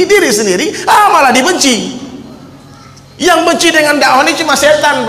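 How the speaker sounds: impassioned preaching. Acted. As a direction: urge the crowd on, raising the voice. Impassioned sermon passage. (ceramah bersemangat dengan suara tinggi dan keras)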